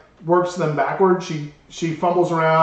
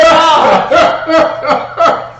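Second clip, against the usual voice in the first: laughing; 11 semitones higher, 9 dB louder than usual. Retold or imitated. Flustered reaction while laughing.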